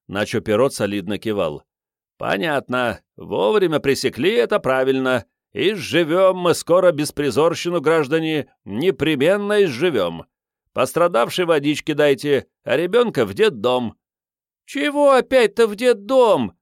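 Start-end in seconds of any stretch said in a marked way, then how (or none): 2.2-13.9 pompous official. Acted. Pompous fake officer speech. 14.6-16.6 high, loud urchin yap; yapped. Acted. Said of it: "вякнула грабительница".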